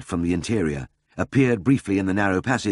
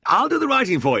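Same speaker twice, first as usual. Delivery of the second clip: high-pitched, cheerful offer; bright-spirited high call; eager cry; 5 dB louder than usual. A narrator's reading. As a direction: cry it out in high good humour. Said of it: "he cried".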